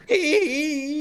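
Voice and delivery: very high, loud drawn-out whoop; hammed-up joyride holler; whooping character cry. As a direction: cry out high as if spinning in circles. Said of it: "He's like".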